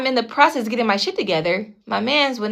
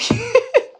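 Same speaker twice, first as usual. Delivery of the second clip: real laugh; 13 semitones higher than usual. Real, not acted. Laughs at his claim of dying.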